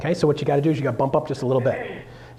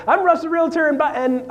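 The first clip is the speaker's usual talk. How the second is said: high, loud eager intro that fumbles; overexcited realtor intro; botched intro; 14 semitones higher, 4 dB louder than usual. Shown as a demonstration. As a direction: rush into the intro too high. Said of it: "if I mess up, I'm like".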